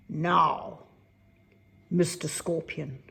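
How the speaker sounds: loud, firm threat building to the end; the fairy warns the scorpion, loud and stern; stern fairy warning. Acted.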